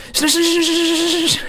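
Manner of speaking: shinu rattled off fast and high. Shown as a demonstration. Showing viewers how fast, shinu said high and fast. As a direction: fire shinu off at speed. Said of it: "like, so fast".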